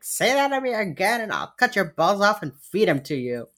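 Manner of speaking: high-pitched hammed-up threat; mock threat. Imitating an agent threatening a suspect, mock-threatening. Acted threat overheard in retold scene.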